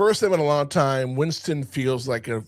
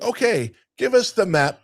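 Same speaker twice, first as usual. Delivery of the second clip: lightly voiced game show host, higher pitch; imitated host; 7 semitones higher than usual. Game show host asking for the map, lightly imitated. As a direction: lift it into a hint of host voice.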